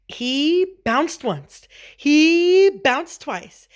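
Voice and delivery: slow, high, stretched storytelling; building bounces. A narrator's reading. Narrator acts out building bounces.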